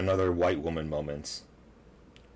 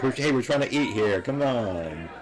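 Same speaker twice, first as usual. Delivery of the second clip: louder, a bit higher mock complaint; annoyed diner; 6 semitones higher, 4 dB louder than usual. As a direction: play a diner protesting the noise. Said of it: "this guy's like".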